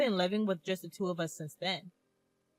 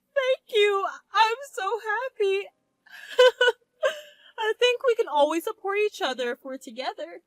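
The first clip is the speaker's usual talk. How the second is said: trembling, high, happy crying; tearful joy; 12 semitones higher, 7 dB louder than usual. Acted. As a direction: choke up with happy tears. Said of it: "With a trembling voice".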